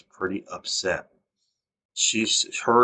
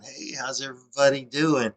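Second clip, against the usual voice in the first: lightly imitated, bright, higher breezy hello; cheery greeting; 6 semitones higher than usual. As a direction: mimic the boss's smiling hello. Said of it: "he's smiling".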